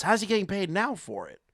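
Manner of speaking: ordinary talk, nothing marked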